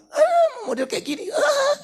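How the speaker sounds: high, laughing dismissive sneer; mock scorn. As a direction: sneer at your spouse in jest. Mocking a dismissive spouse.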